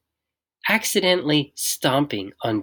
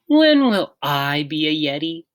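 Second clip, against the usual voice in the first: put-on small child voice; 4 semitones higher, 5 dB louder than usual. A narrator's reading. George asks again.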